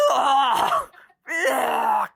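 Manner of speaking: loud high gagging ugh; disgusted groan. Acted. Raptor gagging on skunk smell, loud and high. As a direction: retch it out in disgust.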